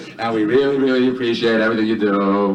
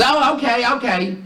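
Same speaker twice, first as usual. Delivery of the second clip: high-pitched falsetto wife; cartoonish high wifely voice; wife's reply; 13 semitones higher than usual. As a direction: put on a high woman's voice, dropping off at the end. Yelling wife answers husband.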